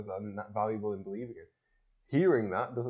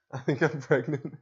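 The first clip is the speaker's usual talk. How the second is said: laughing; 5 semitones higher, 8 dB louder than usual. Pretend. Joking, laughing.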